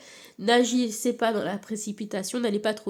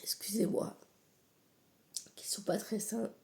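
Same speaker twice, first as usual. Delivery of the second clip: breathless whisper after a coughing fit; hoarse after coughing; 11 dB quieter than usual. Real, not acted. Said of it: "J'ai une quinte de tout".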